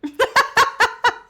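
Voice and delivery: high, loud real laugh; cracking up at the joke; burst of laughter. Real, not acted. Reacts to the Daddy joke.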